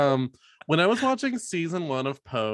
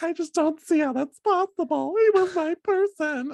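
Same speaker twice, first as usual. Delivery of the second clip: high, tearful mock Rachel voice; playfully mimicking a grieving character, pitched way up; Lea Michele quote; 4 dB louder than usual. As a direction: imitate her heartbroken high singing voice. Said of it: "And she's like".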